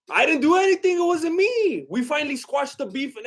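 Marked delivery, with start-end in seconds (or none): 0.1-1.9 high-pitched protest of innocence; hammed-up "not guilty" plea, pitched way up; mock-defensive denial. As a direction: protest loudly in a high, playful whine. Jokingly denies causing the disappearance.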